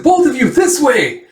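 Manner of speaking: high, loud shout; urgent call. Retold or imitated. Man calls two over during stampede, urgent.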